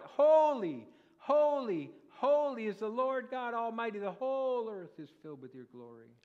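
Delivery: high, loud cry of praise; saints crying out. Acted. Preacher voicing saints around the throne, high loud crying out. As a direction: call it out like the saints round the throne. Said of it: "crying out".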